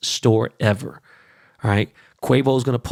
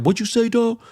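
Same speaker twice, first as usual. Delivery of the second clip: high, mocking voice of a tough guy; mocking Boogie Cousins; 11 semitones higher than usual. Boogie Cousins snapping back at the podcaster, high mock voice. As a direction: puff up like a player squaring up.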